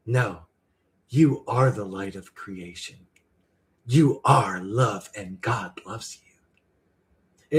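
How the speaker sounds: slow, low, hushed guru voice fading to a whisper; mock spiritual guru. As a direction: parody a serene spiritual teacher. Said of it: "spiritual people talk like this".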